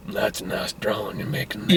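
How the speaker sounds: low, soft creepy stranger voice; hushed, creepy mock friendliness; creepy man. Joe introduces himself to the girl in hospital, creepy. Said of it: "this is super creepy".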